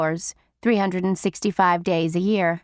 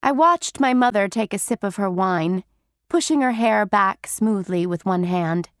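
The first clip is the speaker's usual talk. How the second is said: ordinary delivery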